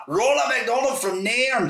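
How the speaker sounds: imitating her proud Scottish self-introduction; Scottish lassie. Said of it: "but it's like".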